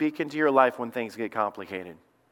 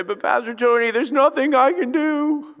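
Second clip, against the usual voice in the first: whiny churchgoer; 7 semitones higher, 4 dB louder than usual. Retold or imitated. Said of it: "And then people say".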